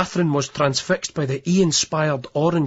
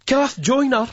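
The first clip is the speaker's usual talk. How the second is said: shouting; 8 semitones higher, slower than usual. Acted. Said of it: "Terry shouts".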